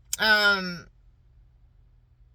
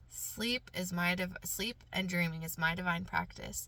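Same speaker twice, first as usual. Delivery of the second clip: bedtime affirmation; 9 dB quieter than usual. Real, not acted. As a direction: recite it gently, as if settling toward sleep. Recites a sleep affirmation.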